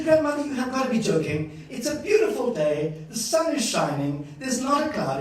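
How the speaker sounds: high, loud incredulous protest; mock disbelief. Grandchild argues with grandmother about rain, mock disbelief. Grandchild arguing in retold story.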